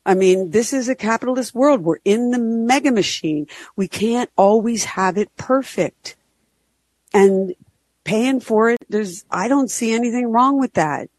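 ordinary delivery

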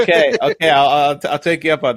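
loud, high, laughing. Real, not acted. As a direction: burst out laughing while talking. Cracking up at caller's joke.

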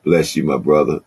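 ordinary talk, nothing marked

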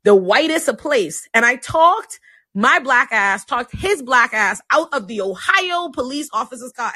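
normal talking voice

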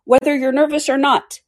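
high, loud threatening king; angrily. Acted. The king threatening the Hatter, high angry threat. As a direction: threaten him with execution. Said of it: "the king repeated angrily".

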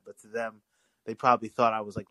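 ordinary talk

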